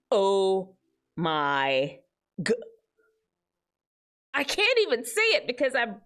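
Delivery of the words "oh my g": slow, stops before the last word; slow cut-off quote. Acted. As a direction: drag it out and break off. Quoting the banned phrase.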